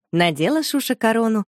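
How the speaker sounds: plain reading voice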